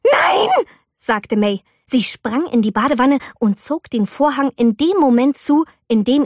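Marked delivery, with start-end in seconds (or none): 0.1-0.5 high sharp no; panicked no. A narrator's reading. Girl stops her mother from entering, panicked high no. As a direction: cry out to stop her.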